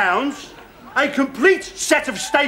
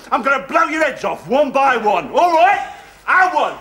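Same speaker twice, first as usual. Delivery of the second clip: normal talking voice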